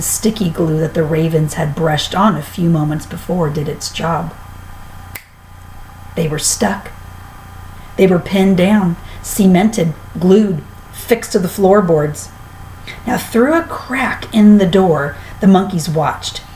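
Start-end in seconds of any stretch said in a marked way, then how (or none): none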